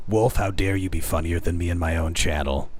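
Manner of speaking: low, menacing mock indignation; mock outrage. Streamer scolds viewer for being funnier, mock outrage. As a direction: drop deep and feign offence.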